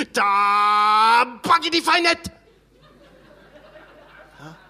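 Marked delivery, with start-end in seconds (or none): 0.1-2.3 slow, drawn-out, stylised martial-arts movie line; hammed-up kung fu villain, each syllable stretched; kung fu film villain. Comedian replaying the insult as a kung fu film line, slow and hammed-up. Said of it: "in einem chinesischen Kung-Fu-Film".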